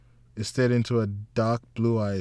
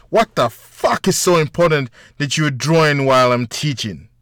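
loud, higher irritated scold; irritated teacher; 5 semitones higher, 10 dB louder than usual. A narrator's reading. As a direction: snap at the girl like a cross teacher. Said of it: "The teacher gets irritated".

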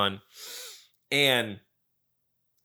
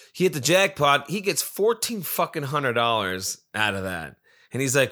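ordinary talk

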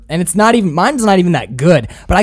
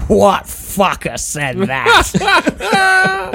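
slow, much higher, drawn-out grand voice; grandiose holding court; 13 semitones higher, much slower than usual. Retold or imitated. Friend retelling the bar scene, grandiose holding-court voice. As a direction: hold court like a boozy aristocrat. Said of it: "says in this, like, grandiose, like, holding court kind of way".

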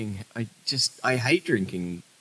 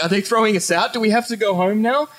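high-pitched mock alarm; fretful questions in a raised, panicky voice; freaking out; 8 semitones higher than usual. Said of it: "I started freaking out".

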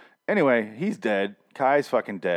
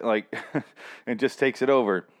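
ordinary delivery

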